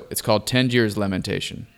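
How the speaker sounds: normal talking voice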